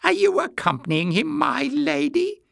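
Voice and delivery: high, teasing question, rising at the end; mischievous. A narrator's reading. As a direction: tease with mock courtesy, voice high. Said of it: "his face alight with mischief".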